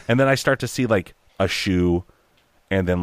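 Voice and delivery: normal talking voice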